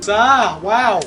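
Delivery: ordinary talk, nothing marked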